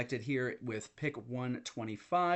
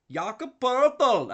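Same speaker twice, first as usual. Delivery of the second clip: "Jakob Poeltl" with a hammed-up Austrian accent, slow, much higher and louder; mock Austrian accent; 12 semitones higher, 12 dB louder, slower than usual. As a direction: belt "Poeltl" in a bad fake Austrian accent, drawn out. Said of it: "another incorrect accent".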